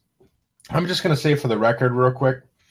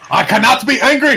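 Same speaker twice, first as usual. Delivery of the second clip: put-on shouted, high-pitched angry German accent; loud mock-furious German; 11 semitones higher, 7 dB louder than usual. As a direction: bellow it in a hammed-up German accent. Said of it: "Do your best angry German voice".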